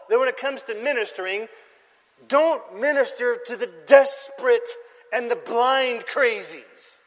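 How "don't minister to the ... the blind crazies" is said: ordinary delivery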